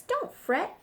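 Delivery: high, squeaky little mouse voice. Acted. Mouse soothing the upset bear, high and squeaky. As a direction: squeak it out to comfort him. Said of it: "Mouse squeaks".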